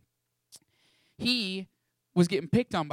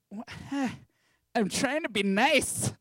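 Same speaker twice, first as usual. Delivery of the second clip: exasperated sigh; 6 semitones higher, slower than usual. Retold or imitated.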